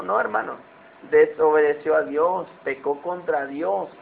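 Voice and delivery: usual speaking voice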